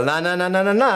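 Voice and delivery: high, rising "la-la-la" style chant; drowning out spoilers. Pretend. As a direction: pretend to block out what you hear.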